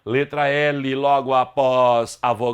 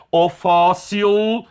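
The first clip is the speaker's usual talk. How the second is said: "o fóssil" drawn out, higher, with FÓ held long; stress on penultimate syllable; 4 semitones higher, 3 dB louder, much slower than usual. Shown as a demonstration. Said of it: "sílaba tônica é a penúltima". ("fóssil" devagar, alongando a sílaba tônica FÓ)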